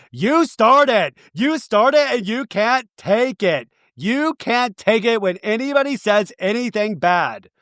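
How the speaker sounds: high, heated accusation, dragged out slowly; mock angry. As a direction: jab every word at her. [name] accusing in the fight.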